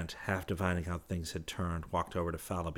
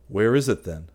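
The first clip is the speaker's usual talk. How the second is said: demanding; 3 semitones higher, 11 dB louder than usual. Acted. Trent pressing for the money.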